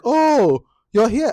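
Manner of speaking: high, loud oh of sudden noticing; mock surprise. [name] suddenly noticing Bisola in the room, mock surprised. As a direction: act as if she only now sees her.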